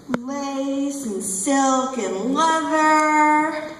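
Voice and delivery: slow, drawn-out relish; savouring. Acted. Dominatrix recalling her outrageous outfits, savouring. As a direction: linger sensually on each fabric.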